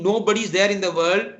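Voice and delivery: normal talking voice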